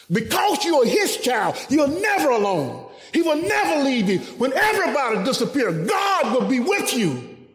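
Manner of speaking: builds to a full preaching shout; preaching at full force. Acted. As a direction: climax of the sermon, calling it out. Preaching climax, rising to shouts.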